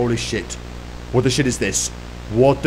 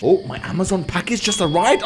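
ordinary talk, nothing marked

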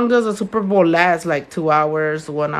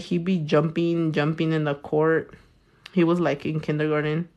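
normal talking voice

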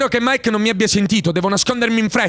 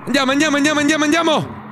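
higher, fast repeated urging; urgent urging; 6 semitones higher, faster than usual. Real, not acted. Fleeing with the kids, fast excited urging. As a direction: rush everyone out.

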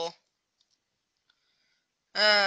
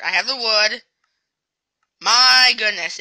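ordinary talk, nothing marked

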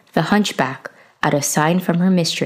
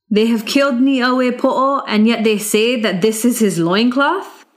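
higher, incredulous retort; young hero scoffing; 5 semitones higher than usual. Acted. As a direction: throw their words back at them. Youth scorns the guards' answer.

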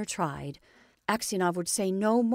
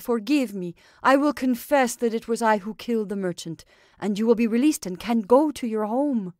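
louder, urgent begging; pleading; 3 semitones higher, 8 dB louder than usual. A narrator's reading. Makar kneeling begs Aksionov for forgiveness, urgent plea. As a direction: beg him on your knees for forgiveness.

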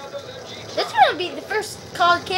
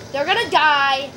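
normal talking voice

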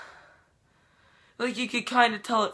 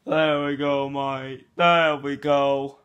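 louder lightly Aussie-tinged doctor voice; mock doctor; 5 dB louder than usual. Fake doctor assuring the vlogger, lightly Aussie and louder. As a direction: play a dodgy bloke doctor. Plays a doctor saying mate.

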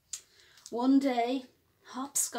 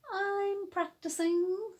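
higher, slower little-bunny voice; proud small child answer; 8 semitones higher, slower than usual. Acted. Hopscotch answering daddy proudly. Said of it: "said Hopscotch".

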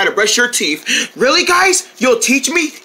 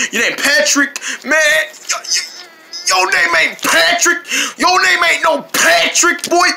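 brash, teasing fish; 8 semitones higher than usual. Acted. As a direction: scoff at the name, pitched up high. [name] mocks the fish's name.

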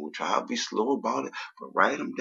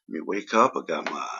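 ordinary delivery

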